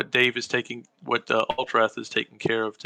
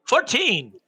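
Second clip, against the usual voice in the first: higher and louder, clearly; louder repeat; 10 semitones higher than usual. Acted. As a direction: say it again so they hear. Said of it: "I'm sorry, what did you say".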